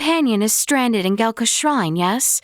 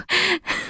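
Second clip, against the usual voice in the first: breathy amused chuckle; 8 semitones higher than usual. Acted. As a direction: laugh it away gently. Laugh at getting used to.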